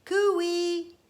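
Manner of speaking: high calling cooey; shouted call. Acted. Karen calling out to test the echo. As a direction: call out across the hills. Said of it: "shouted Karen".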